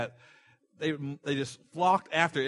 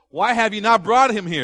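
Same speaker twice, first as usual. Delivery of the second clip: loud, high, demanding why; indignant shout; 6 semitones higher, 8 dB louder than usual. Acted. Pharisees shouting at the officers who returned empty-handed. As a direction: bark the question at the officers.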